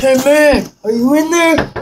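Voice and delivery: loud call through a door. Acted. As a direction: shout to someone inside.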